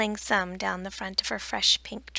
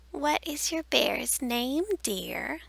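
put-on slow, higher old lady voice; old woman voice; 5 semitones higher, slower than usual. A narrator's reading. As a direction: ask it sweetly like an old lady. Old Mrs Swink asks child.

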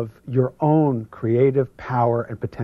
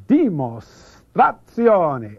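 slow, raised, theatrical Italian; Italian accent; 4 semitones higher, 6 dB louder, much slower than usual. Shown as a demonstration. Speaker unveiling a principle to an audience, big Italian accent. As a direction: stretch every syllable in an Italian accent. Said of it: "your best Italian".

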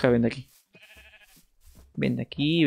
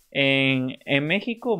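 ordinary talk

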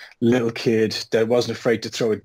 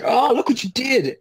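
high, pained accusing cry; mock agony; 11 semitones higher than usual. Prankster on the ground blaming the kid, in mock agony. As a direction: wail it in put-on agony at the culprit.

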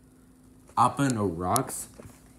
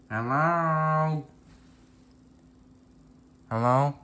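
calling out; 3 semitones higher, 6 dB louder, slower than usual. Acted. Calling out for lost friend.

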